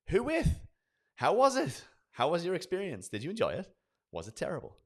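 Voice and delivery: high, loud, bubbling with interest; eager curious questions. Shown as a demonstration. Salesman eagerly quizzing client John about his last build. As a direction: fire off questions like you can't wait to hear.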